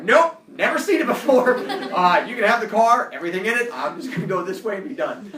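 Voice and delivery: loud, high, hammed-up denial; breezy denial. Driver imagines writing off his car to the cops, loud mock denial. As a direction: disown it loudly, half laughing.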